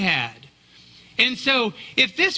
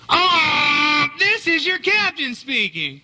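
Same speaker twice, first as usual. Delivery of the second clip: mock ship captain shouting; 4 semitones higher, slower than usual. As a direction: bellow the robocall greeting like a ship captain. Mimicking robocall captain again.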